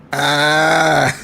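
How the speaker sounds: loud shout then howling laughter; loud burst of laughter. Real, not acted. Howling laughter at the clip's remark.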